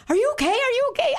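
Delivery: high, anxious repeated question; imitating worried friend. Nan on the phone asking her pastor, high and anxious. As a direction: sound frantic for a friend's safety. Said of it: "and Nan's going".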